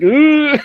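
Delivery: high, loud "ooh" of nerves; mock anxious squeal. Actor recalling panic at landing the main character, high anxious squeal. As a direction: squeal it like a nervous reaction retold. Retold anxious reaction to role.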